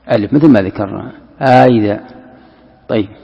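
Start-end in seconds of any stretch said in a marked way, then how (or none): 1.4-2.0 آ إذا with a long aa between the hamzas; inserted alif. Shown as a demonstration. Teacher demonstrating the inserted alif, long aa in آ إذا. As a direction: stretch the first vowel of آ إذا before idha. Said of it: "بين الهمزتين تدخل ألف".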